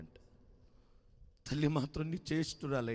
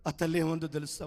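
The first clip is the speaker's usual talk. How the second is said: ordinary delivery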